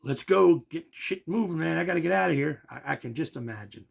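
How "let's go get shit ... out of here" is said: loud, higher impatient yell; imitated street yell, get moving. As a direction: hurry the driver along, shouting. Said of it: "she's yelling".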